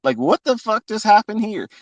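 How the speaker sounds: sudden high-pitched disbelief; stunned disbelief. Retold or imitated. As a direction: jump way up in pitch, shocked. Recalling his shock.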